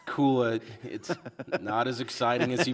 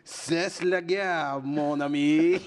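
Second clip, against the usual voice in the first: put-on loud, high, drawn-out French accent; milk the French sign-off slowly and loudly; mock French; 6 semitones higher, 9 dB louder, slower than usual. Stan signing off to the French fan, mock French accent.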